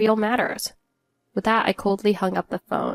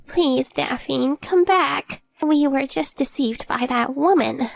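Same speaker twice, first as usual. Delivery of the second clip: put-on higher, pleading older in-law voice; feeble mother-in-law; 6 semitones higher, slower than usual. A narrator's reading. Mother-in-law begs her daughter-in-law to return, feebly. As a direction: beg feebly, pitched up. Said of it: "I heard a feeble voice from my mother-in-law".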